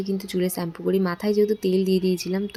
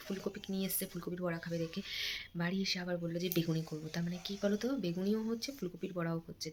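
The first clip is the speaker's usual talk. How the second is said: speaking softly; 13 dB quieter than usual. Real, not acted. Vlogger to viewers while the little one sleeps, speaking very softly. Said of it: "আস্তে আস্তে কথা বলছি".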